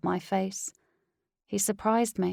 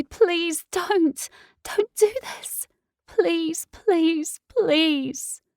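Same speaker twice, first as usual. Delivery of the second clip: high, loud, tearful pleading; desperate begging; 6 semitones higher, 5 dB louder than usual. Acted. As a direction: beg him, rising into panic. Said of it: "I beg and beg".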